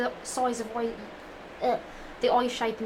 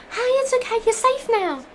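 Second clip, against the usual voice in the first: put-on much higher, louder soothing girl voice; high comforting voice; 13 semitones higher, 6 dB louder than usual. Rachel comforting the crying Mina, high and soothing. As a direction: coo it in a high girly voice.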